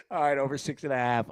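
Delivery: ordinary talk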